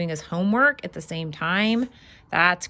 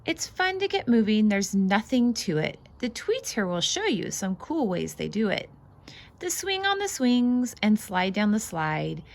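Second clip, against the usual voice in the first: lively, bouncy read-aloud voice; singsong picture book reading; reading to children; 3 semitones higher than usual. Acted. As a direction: read it out like a storybook for little ones. Reads picture book aloud to kids.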